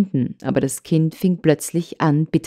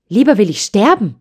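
a raised, higher angry cry; child refuses in anger, raised higher voice; angry outcry; 5 semitones higher, 4 dB louder than usual. Acted. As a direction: snap back in anger. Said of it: "rief das Trini zornig".

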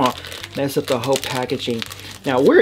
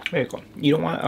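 normal talking voice

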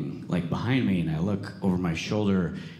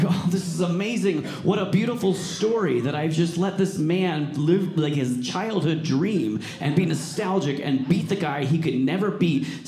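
gushing delight; 8 semitones higher, 3 dB louder than usual. Retold or imitated. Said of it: "I'm like".

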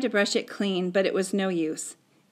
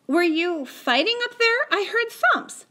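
high-pitched motherly inquiry; questioning mother; 12 semitones higher, 3 dB louder than usual. Acted. Mother asking about thumps upstairs, high-pitched questioning. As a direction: question your son in a raised voice.

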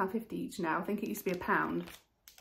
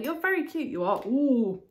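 ordinary delivery

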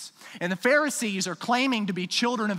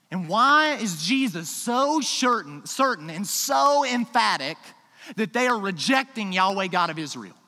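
fervent preaching; 3 semitones higher, 4 dB louder than usual. Real, not acted. Preaching at full force.

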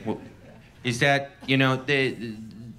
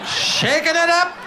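excited prospector. Retold or imitated. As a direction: yelp like an old miner striking gold. Gold miner prospector act.

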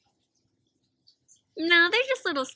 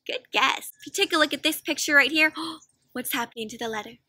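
usual speaking voice

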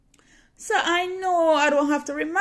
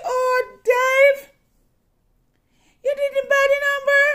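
high and loud, on the edge of crying; shocked disbelief; 9 dB louder than usual. Real, not acted. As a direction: gasp it out in disbelief. Shocked question to husband.

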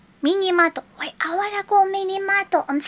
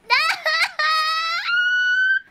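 drawn-out squealing cry; long high wail; 13 semitones higher than usual. Real, not acted. Streamer losing the run, long high wail. Caught by game, loses run.

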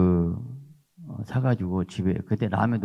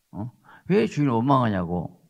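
usual speaking voice

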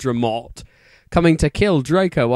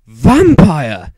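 loud call; 9 dB louder than usual. A narrator's reading. Shouting a name, loud far.

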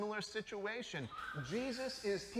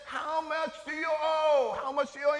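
loud, higher call to the debtor; 7 semitones higher, 6 dB louder than usual. Acted. Manager summoning the first debtor, loud higher call. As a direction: bark it across the room.

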